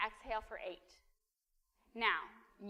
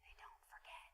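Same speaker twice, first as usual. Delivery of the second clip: whispered echo; 20 dB quieter than usual. Acted. Pastor echoing to parents that kids never forget, whispered. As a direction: whisper it even softer.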